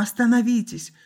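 high, loud plea to stop; distressed shouted appeal; wailing. A narrator's reading. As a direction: cry out desperately. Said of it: "завыла Анна".

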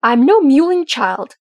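loud, high, drawn-out angry retort; slow, forceful outburst of offended pride; temper flaring. A narrator's reading. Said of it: "his temper flaring".

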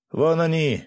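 high, loud yell. A narrator's reading. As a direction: shout and point. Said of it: "заорало сразу несколько человек".

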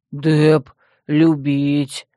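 low, slow brute voice; stubborn mutter. A narrator's reading. Dep steels himself against wolves, stubborn low drawl. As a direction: mumble it doggedly like a brute. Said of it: "упрямо пробормотал он".

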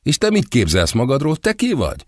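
high, mocking throw-back of the question; icy insolence. A narrator's reading. Blonde model answering the designer, icily insolent. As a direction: fire his words straight back at him. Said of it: "vérfagyasztóan flegma marad".

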